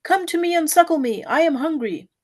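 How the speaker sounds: a hint of a wailing infant calling mother; mild crying child. A narrator's reading. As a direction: plead for mother like a hungry baby. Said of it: "beckoned his mother by crying".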